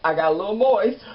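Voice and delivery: high, loud squeak as if near tears; hammed-up tearful confession, voice pushed way up; mock choked up. Viewer admits crying over Melissa, mock choked up. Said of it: "I cried everything".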